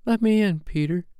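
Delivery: very high, tinkling fairy voice; high-pitched fairy plea; lovely bell-like voice. Acted. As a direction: chime it like a little bell. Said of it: "in a lovely bell-like voice".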